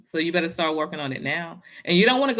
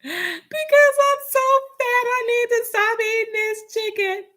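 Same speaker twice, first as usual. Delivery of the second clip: mock crying; 4 dB louder than usual. Teen self crying to mama about being fat, high sob. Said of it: "I started crying".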